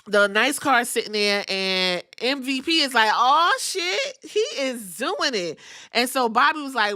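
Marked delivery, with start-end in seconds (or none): 3.1-5.6 shocked exclaim. Retold or imitated. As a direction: blurt it out like you can't believe it. MVP reacts to the car.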